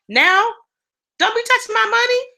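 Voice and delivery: high, playful possessive warning; guard your money with sass; sassy warning. Acted. To anyone near her savings, high sassy warning.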